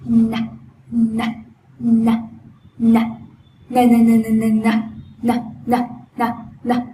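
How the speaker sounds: loud stiff-tongue N drill. Shown as a demonstration. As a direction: drill "nà" loudly. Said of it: "hãy đặt đầu lưỡi".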